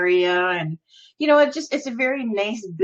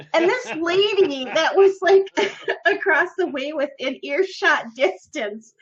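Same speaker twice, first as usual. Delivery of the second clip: ordinary talk, nothing marked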